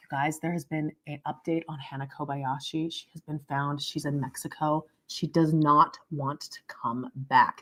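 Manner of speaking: deadpan a grave breaking-news update; hammed-up serious newscaster; mock news report. Mock news bulletin after "breaking news.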